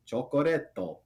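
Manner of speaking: "chocoretto", each syllable sounded with a tapped r; Japanese accent. Shown as a demonstration. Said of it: "is how you say chocolate".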